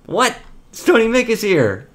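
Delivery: high surprised what; surprised, voice shoots up. Retold or imitated. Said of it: "I'm like".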